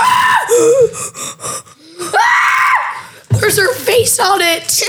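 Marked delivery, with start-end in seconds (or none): 0.0-3.1 shrill gasp and shriek; startled scream. Real, not acted. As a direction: shriek in sudden shock. Startled by face on packaging.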